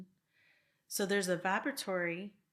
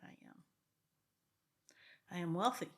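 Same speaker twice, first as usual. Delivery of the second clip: affirmation demo; 3 dB louder than usual. Shown as a demonstration. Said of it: "So I say things like".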